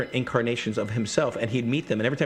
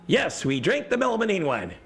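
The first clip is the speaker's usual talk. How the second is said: exasperated repeat; 10 semitones higher than usual. Retold or imitated. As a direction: say it again like they're being dense.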